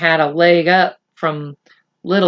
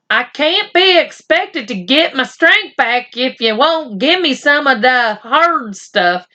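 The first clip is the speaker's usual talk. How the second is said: Boone whining; 7 semitones higher than usual. Acted. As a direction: wheedle for whiskey like a sly old cowboy. Boone whines for whiskey.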